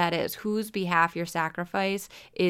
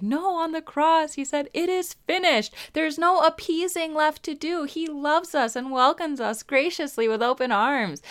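higher-pitched, certain inner voice; mock inner Protestant voice, pitched up; imitating her Protestant mind; 8 semitones higher than usual. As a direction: voice the objection in a raised, confident tone. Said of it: "my Protestant mind is like".

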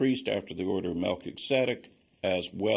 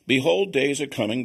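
proclaiming scripture; 5 semitones higher, 6 dB louder than usual. Acted. Reads Jeremiah 31 aloud.

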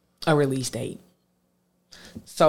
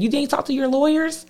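voicing mom; 11 semitones higher than usual. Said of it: "She said".

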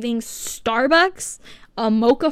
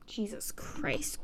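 slow, much softer breathy sigh of exasperation; whispered exasperation; 2 semitones lower, 15 dB quieter, slower than usual. Real, not acted. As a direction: whisper it, completely fed up.

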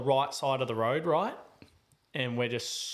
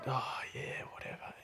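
near-whispered, dismissive mumble; listless muttering; 10 dB quieter than usual. Retold or imitated. Talking to Bonnie on the drive, retold listless mutter. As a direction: let it sag away under the breath. Retells his listless phone chat.

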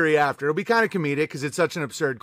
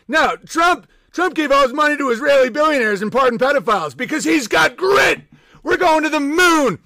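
much higher, louder, frantic hammed-up yell; mock shouting fan; 7 semitones higher, 4 dB louder than usual. Streamer mocking a Trump supporter's ranting. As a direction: bellow like a crazed MAGA fan.

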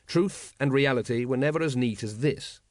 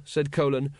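plain reading voice

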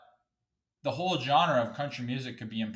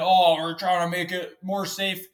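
mocking quote; 5 semitones higher, 6 dB louder than usual. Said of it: "And people are saying".

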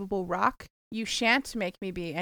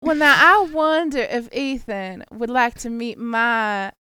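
slow, louder Southern drawl; Southern accent; 5 dB louder, slower than usual. Pretend. Host offering her friend Betty Lou to Ethan, Southern drawl. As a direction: play a sweet Southern matchmaker, slowly.

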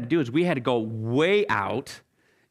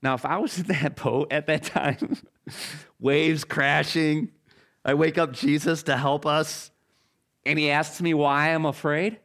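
ordinary delivery

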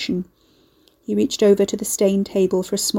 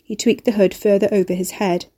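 plain reading voice

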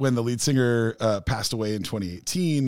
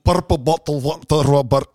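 louder, thick mock Scottish brogue, all consonants; Glasgow accent; 2 semitones higher, 6 dB louder than usual. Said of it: "it's just consonants".

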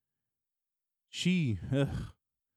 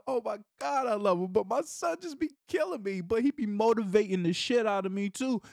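ordinary talk

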